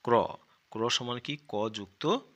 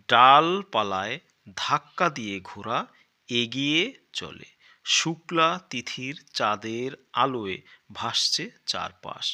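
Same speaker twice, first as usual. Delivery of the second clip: loud, slow storybook reading; reading aloud; 7 dB louder, slower than usual. Acted. Teacher reading the primer story to children, loud and slow. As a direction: read the passage out grandly for the class.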